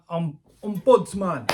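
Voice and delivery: ombudsman tried slowly, "om-" then "ombudsman"; unsure ombudsman. Real, not acted. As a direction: sound out ombudsman carefully, unsure of it. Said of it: "I don't know how you say ombudsman".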